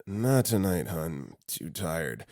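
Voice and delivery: mumbled half-asleep dad, trailing off very soft; sleeping father. Retold or imitated. Half-asleep dad turning down his wife, drowsy murmur. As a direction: murmur it slowly, drifting back to sleep. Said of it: "to my sleeping father".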